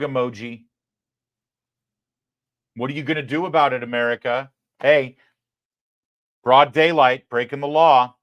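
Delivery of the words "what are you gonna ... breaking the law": loud, fast, brazen taunt; taunting shrug. Retold or imitated. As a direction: taunt them like you can't be stopped. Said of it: "Just a big old shrug".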